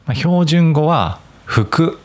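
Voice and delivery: 服 in standard Tokyo pitch, high first mora; 標準語アクセントで「服」; standard fuku. Shown as a demonstration.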